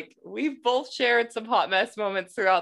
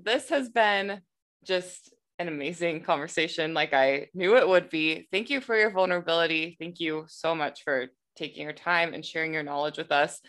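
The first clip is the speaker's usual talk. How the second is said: usual speaking voice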